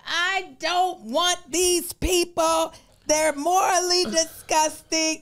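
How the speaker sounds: high, slow mock-righteous politician voice; drawn out in pious disgust.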